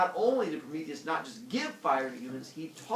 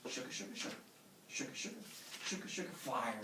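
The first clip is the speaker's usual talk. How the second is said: soft, whispery rhythmic rubbing sound; stick-rubbing noise; 11 dB quieter, faster than usual. Acted. God rubs two sticks for fire, soft chant. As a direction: make the sticks rasp softly in rhythm. Imitates sticks rubbed together.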